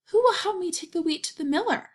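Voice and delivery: high, quick hen voice; a bright little hen calling for helpers; hen asking for help. Acted. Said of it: "she asked".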